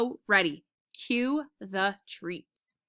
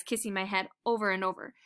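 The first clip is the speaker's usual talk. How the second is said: plain reading voice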